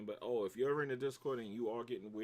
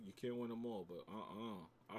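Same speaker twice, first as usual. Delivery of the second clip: usual speaking voice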